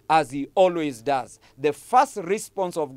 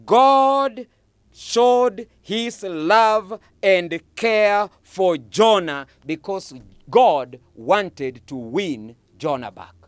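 slow emphatic; 4 dB louder, slower than usual. Acted. Preacher slows for God's love.

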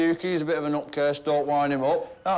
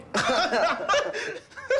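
burst of laughter; 11 semitones higher than usual. Real, not acted. Mocks the name Harvey.

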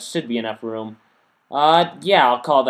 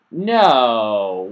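sudden louder no, voice rising then dropping; exasperated no; 2 semitones lower, 6 dB louder than usual. Real, not acted. Gamer sees the water flood his build, exasperated louder no. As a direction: blurt it out as the water goes wrong.

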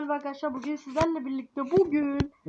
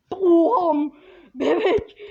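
shocked shout; 2 semitones higher, 7 dB louder, much slower than usual. Real, not acted. Shocked by baby's fall.

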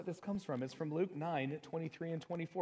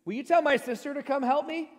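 Jesus, high and loud, exasperated; irritated demand; 9 semitones higher, 8 dB louder than usual. Retold or imitated. Martha, overworked, complains loudly to Jesus about her sister. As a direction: snap at Jesus to make her sister help. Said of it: "Martha gets irritated".

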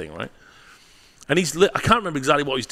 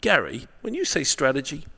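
mock questioner; 3 semitones higher, 3 dB quieter than usual. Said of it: "people say to me all the time".

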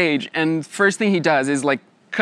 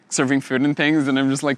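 usual speaking voice

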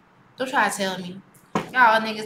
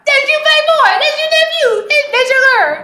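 put-on much higher, excited hyping up the girl; high-pitched hyped-up teasing; high hype voice; 4 dB louder than usual. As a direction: squeal it like a hype man. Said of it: "They be like".

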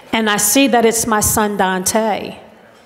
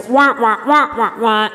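lightly imitated muffled adult wah wah; Charlie Brown wah-wah. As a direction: mimic the grown-ups' droning wah wah from Charlie Brown. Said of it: "sounds like I'm in a Charlie Brown movie".

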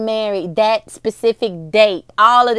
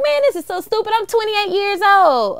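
exasperated; 10 semitones higher than usual. Retold or imitated. Retold self, upset at mom.

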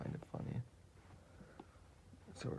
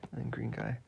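ordinary delivery

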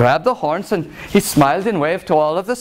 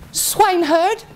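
high-pitched fairy-tale lady voice; lady in waiting; 13 semitones higher than usual. Acted. Lady in waiting addressing the swineherd, high voice. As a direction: call out in a high, put-on voice.

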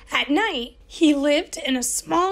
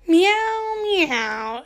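high pitiful cat mew; a sorry little cat mewing; pitiful meow; 6 semitones higher than usual. A narrator's reading. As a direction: mew like a miserable seasick cat. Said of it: "agreed Hamish pitifully".

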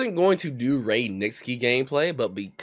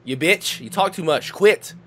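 higher, louder barked repeats; angry yelling; 10 semitones higher, 7 dB louder, slower than usual. Real, not acted. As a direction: shout quit at him over and over. Heated argument with friend.

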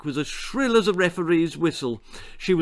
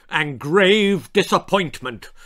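loud, higher, disapproving mother; grave disappointment; 5 semitones higher, 5 dB louder than usual. A narrator's reading. Frowning mother backs up her husband, gravely disappointed. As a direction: frown through a weighty pronouncement. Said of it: "added her frowning mother".

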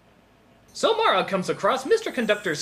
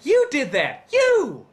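high, punched accusation, falling off; mock accusing shout; 5 semitones higher, 3 dB louder, slower than usual. Critic blaming the viewers, mock accusing shout. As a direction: accuse them with a hammed-up shout.

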